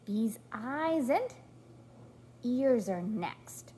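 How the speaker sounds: normal talking voice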